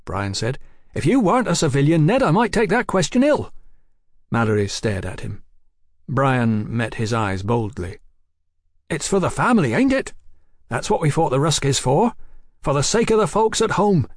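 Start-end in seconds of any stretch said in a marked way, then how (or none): 0.9-3.5 high, loud, quick bristling retort; affronted soldier. Acted. 8.9-14.1 high, earnest soldier's voice; loyal soldier brother. Acted.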